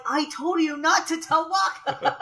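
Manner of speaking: high, drawn-out mock scolding through laughter; imitating Lulu. Lulu scolding Tidus for telling Waka, high mock exasperation. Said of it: "but Lulu's like".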